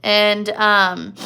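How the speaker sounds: ordinary delivery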